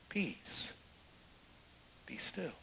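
soft, hushed, steady authority; calm command. Retold or imitated. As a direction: still the storm gently. Jesus calming the storm.

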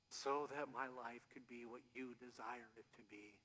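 soft, low prayer voice fading to a whisper; hushed prayer. Real, not acted. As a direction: lead them into surrender quietly. Prayerful quiet close.

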